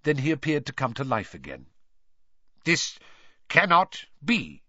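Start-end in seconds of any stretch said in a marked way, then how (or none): 2.6-4.6 jerky. A narrator's reading. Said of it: "he said jerkily".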